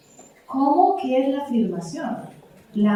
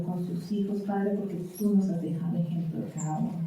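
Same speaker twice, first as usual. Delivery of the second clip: soft, reverent closing prayer; 3 semitones lower, 4 dB quieter than usual. Acted. Pastor praying for parents at close, soft and reverent. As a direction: pray quietly and humbly to the Lord.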